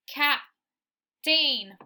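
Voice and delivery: Captain in two parts, "cap... tain"; wrong sounded-out captain. Shown as a demonstration. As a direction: sound out Captain, rhyming the end with "rain". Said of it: "we don't say captain".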